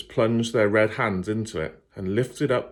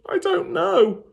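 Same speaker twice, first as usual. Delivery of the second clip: much higher, small scared voice; frightened girl; 5 dB louder, slower than usual. Acted. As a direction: whisper out the confession. Lila answers the fiend.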